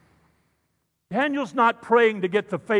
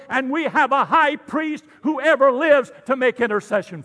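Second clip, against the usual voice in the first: rising, louder, slower preacher build; preaching at full force; 5 semitones higher, 6 dB louder than usual. Acted. Pastor preaching to the congregation, building loud. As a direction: lift it up to a full-voiced climax.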